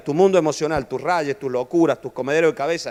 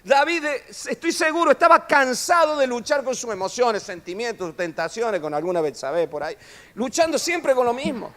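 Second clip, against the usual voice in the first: fervent preaching; 7 semitones higher than usual. Acted. Preaching at full force.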